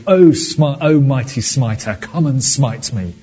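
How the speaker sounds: loud hammed-up cry to the heavens; movie line yell. Preacher quoting Bruce Almighty, hammed-up loud plea. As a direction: belt it out as a mock plea to God. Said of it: "and goes".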